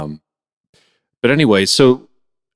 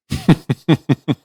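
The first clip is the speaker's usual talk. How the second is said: high, short burst of laughter; real high chuckle; amused laugh; 9 semitones higher, 4 dB louder than usual. Real, not acted. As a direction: giggle at the story. Host amused by the story.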